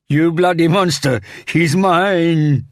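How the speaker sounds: high, loud anguished scream; tearful wail. A narrator's reading. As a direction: wail it out at full force. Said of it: "he wailed through tears".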